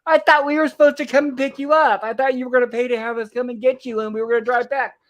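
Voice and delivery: mock angry shout; retold shouting. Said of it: "immediately starts shouting and yelling".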